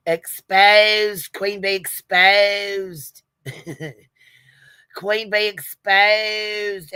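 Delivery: slow, high, drawn-out British announcer-style exposed; hammed-up posh British proclamation; mock British accent. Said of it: "do you guys love my accent there".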